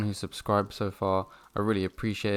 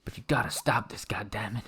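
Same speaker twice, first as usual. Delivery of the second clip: whispered plea. Acted. Pleading with berserk knight.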